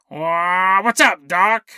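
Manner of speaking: drawn-out cartoon rabbit catchphrase; Bugs Bunny. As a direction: imitate the cartoon rabbit, stretching the opener. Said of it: "And Mel Blanc goes".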